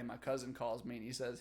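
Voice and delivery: ordinary delivery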